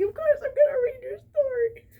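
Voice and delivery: high, loud gushing squeal; gushing quote. Retold or imitated. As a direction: squeal it like an overjoyed fan. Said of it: "and I was like".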